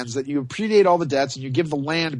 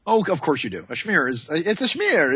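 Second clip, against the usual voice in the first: ordinary talk, nothing marked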